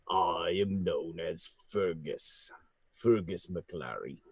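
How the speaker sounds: low, soft, slow grand voice; deep fey introduction. Acted. Fergus introducing himself to Lilith, low and slow. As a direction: announce your name with quiet gravity. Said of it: "the individual says".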